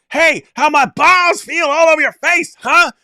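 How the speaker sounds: high, loud, slow jeering yell; mock taunting shout. Javar imitating what he would yell at the guy, mock jeering shout. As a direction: jeer it in a big mock shout. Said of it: "Javar's like".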